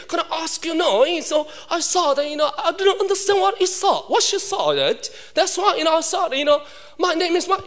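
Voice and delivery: high, slow mock British babble; imitated British teacher rambling on; singsong put-on British accent; British English. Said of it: "This is a British English".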